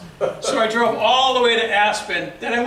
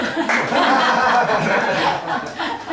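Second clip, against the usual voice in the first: laughing; 4 dB louder than usual. Real, not acted. Laughs at own accent.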